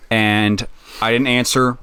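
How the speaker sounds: ordinary delivery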